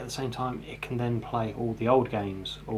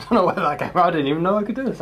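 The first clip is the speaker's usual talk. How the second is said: loud, higher put-on African accent; 6 semitones higher, 8 dB louder, faster than usual. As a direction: keep the African accent going, louder. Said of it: "Oh, African accent".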